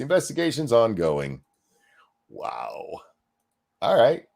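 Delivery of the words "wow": whispered wow. Real, not acted. Reacting to the news.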